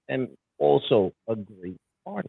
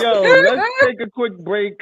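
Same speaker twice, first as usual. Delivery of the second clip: laughing; 8 semitones higher, 7 dB louder, slower than usual. Real, not acted. Laughing while announcing break.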